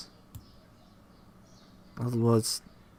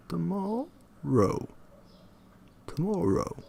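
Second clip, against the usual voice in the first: "tomorrow" tried over, pitched higher, rising then falling; practising rise-fall; 6 semitones higher, 3 dB quieter than usual. Shown as a demonstration. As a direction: rehearse the insistent "tomorrow" tune. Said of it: "convey a degree of insistence".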